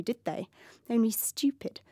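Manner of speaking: ordinary delivery, nothing marked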